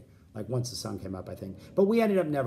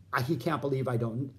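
usual speaking voice

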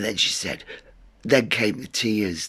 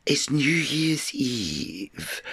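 slow, pointed whisper; 4 dB quieter, much slower than usual. Retold or imitated. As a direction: hush it out slowly, stressing New Year's Eve. Quoting self to angry visitor.

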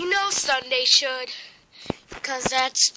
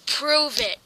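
usual speaking voice

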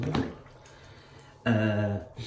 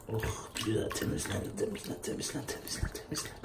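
very soft, unvoiced murmur; soothing whisper; 4 semitones lower, 13 dB quieter than usual. Real, not acted. Owner washing his animals, murmuring in a whisper. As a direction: whisper it gently, over and over.

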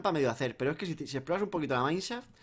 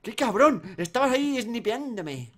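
louder, higher shouts; playful yell; 8 semitones higher, 7 dB louder, slower than usual. Real, not acted. Gamer shouting at a friend who was sniping him. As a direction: yell at him to put it away.

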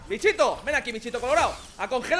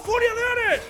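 high shouted battle cry; 11 semitones higher, 4 dB louder, slower than usual. Real, not acted. Gamer yelling his special move at enemies. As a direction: bellow the attack's name.

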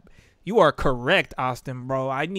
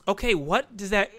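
ordinary talk, nothing marked

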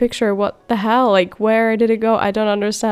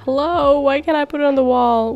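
usual speaking voice

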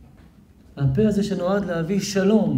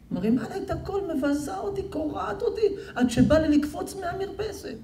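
distressed husband; 11 semitones higher, 7 dB quieter than usual. Retold or imitated. Husband's complaint retold.

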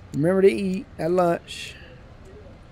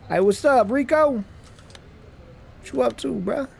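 a bit higher, casual bro greeting; lightly voiced coworker; 7 semitones higher than usual. Coworker greeting Rico, mildly higher casual voice. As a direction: toss off a friendly hello to a buddy. Voicing game coworker dialogue.

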